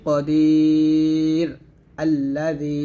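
very slow কদির, a stop, then আল্লাযী; slow recitation demo; tajweed stop form. Shown as a demonstration. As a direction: halt on কদির, then start আল্লাযী fresh. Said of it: "আল্লাযী বলতে হবে".

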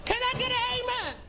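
rally the crowd like a general; roaring rallying speech. Acted.

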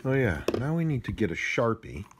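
normal talking voice

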